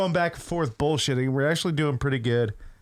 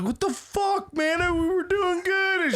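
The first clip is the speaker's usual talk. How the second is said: much higher, slow whiny complaint; whiny gamer; slower than usual. Retold or imitated. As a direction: whine like a baffled teammate. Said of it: "The other two guys are like".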